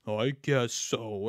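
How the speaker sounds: slow, low, reluctant mumble; Chris Pratt. Acted. Actor half agreeing to a Garfield voice, low and slow. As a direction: drag it out low, unsure. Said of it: "And he's like".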